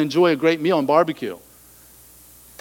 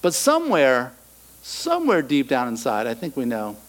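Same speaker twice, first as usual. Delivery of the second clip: normal talking voice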